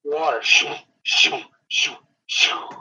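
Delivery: airy shoo shoo of flying limbs; whooshing sound effect; imitated whooshing. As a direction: breathe out each shoo like wood through air. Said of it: "and you hear".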